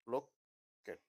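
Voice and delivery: ordinary delivery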